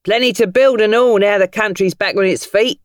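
high, loud cheerful cabbie; grinning cabbie. Acted. Cabbie grinning at Tom through the mirror. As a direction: say it with a grin in the voice. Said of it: "The cabbie grinned".

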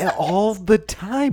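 high, drawn-out words through laughter; laughing complaint. Real, not acted. Host griping about the show's quirk, laughing. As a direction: stretch it out while cracking up.

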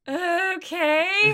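high, drawn-out uneasy agreement; creeped out. Retold or imitated. Kid reacting to talk of death and ghosts, creeped out. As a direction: let it climb, unsure and weirded out. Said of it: "you can tell he's creeped out".

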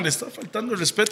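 normal talking voice

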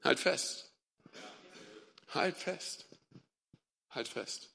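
very soft, hushed and intimate; a quiet voice urging someone to hold on; still small voice. Acted. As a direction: say it barely audibly, pausing between each. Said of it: "diese leise Stimme vielleicht, die dir sagt".

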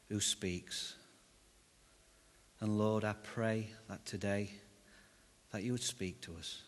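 soft, hushed prayer voice. Acted. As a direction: pray quietly to God. Opening prayer.

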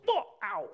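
imitated donkey "ow"; donkey's yelp. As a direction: yelp as if yanked by the bridle. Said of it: "you yank the bridle, and it goes".

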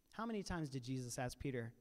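ordinary talk

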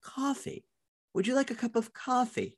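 high, soft, prim coffee said as kah-fee; a put-on proper voice with no New York sound; proper newsreader voice; prim proper accent. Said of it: "speak as prim and proper as you best can".

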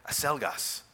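"Acelgas" with an s sound after the A; Panamanian pronunciation. Retold or imitated. Friend repeats his restaurant order to the waiter, Panamanian pronunciation. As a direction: say "Acelgas" Latin American style, c as s. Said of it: "each nation speaks Spanish is a little different".

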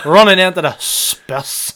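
put-on "Running into the spiss" slowed down in a Northern Irish accent, breaking into laughter; Northern Irish commentator mimicry cracking up; laughing commentator impression. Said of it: "Every time I do the Nordy accent".

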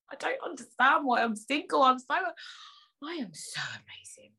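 mock diva whisper; vain diva. Diva self-praise parody.

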